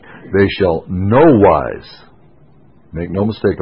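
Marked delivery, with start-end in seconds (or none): 0.0-2.0 slow, heavy stress on every word of "They shall no wise"; emphatic. Shown as a demonstration. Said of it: "in the Greek it's emphatic".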